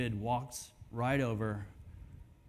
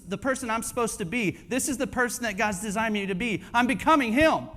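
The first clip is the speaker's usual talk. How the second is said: high, quick, rising self-encouragement; building confidence; 7 semitones higher, 4 dB louder, faster than usual. Acted. Believer realizing life after God is getting easier, increasingly excited. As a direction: rattle it off, growing louder to a triumphant finish. Acts believer gaining momentum.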